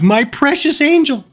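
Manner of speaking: high, gushing owner cooing at her dog; doting baby talk. Retold or imitated. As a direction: coo over the little dog. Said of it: "And now I'm just like".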